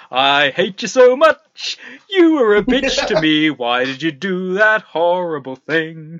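high, loud, gleeful delivery of angry words; cheerful hate. Acted. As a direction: shout insults like happy news. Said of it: "I'm laughing away, dancing away".

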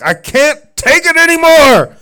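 high-pitched, very loud acted yell; Uncle Bob losing it. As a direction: play an uncle at breaking point. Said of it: "loses his mind".

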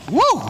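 sudden high yelp of pain; a high whooping cry; mock yelp. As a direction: yelp it like you just stubbed your toe. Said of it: "and out comes".

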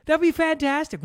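high and loud, delighted by the idea; excited. Real, not acted. Pitching a spoof video to his co-host, gleefully loud. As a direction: sell the joke with glee.

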